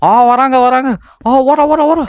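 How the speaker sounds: very high, loud frantic warning; shrieked, urgent repeats; panicked shout. Real, not acted. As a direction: yell in panic as they close in. Someone coming, player panics to hide.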